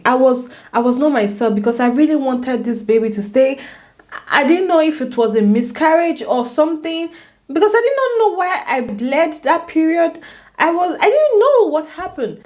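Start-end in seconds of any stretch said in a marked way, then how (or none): 4.3-12.4 high-pitched, agitated retelling; upset, bewildered. Real, not acted. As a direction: relive the confusion, pitch climbing. Reliving the confusion and distress.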